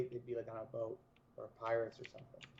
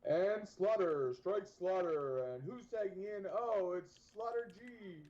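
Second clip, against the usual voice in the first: wrestling commentator; 5 semitones higher, slower than usual. Pretend. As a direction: stretch every word like a ringside hype man. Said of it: "Sound like a wrestling announcer from the nineties".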